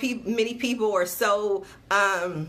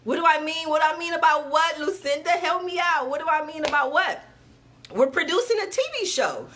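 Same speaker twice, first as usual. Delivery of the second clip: playful excited; 6 semitones higher than usual. Real, not acted. Playful teasing to Lucinda.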